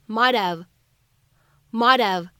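"might have" linked as "mad-av", slow; flapped might have. Shown as a demonstration. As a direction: drop the h in "might have", flap the t. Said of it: "我们可以读成".

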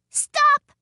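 high, loud cry of "stop"; sudden high-pitched yell. A narrator's reading. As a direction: call out sharply in a little woman's high voice. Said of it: "cried the little woman".

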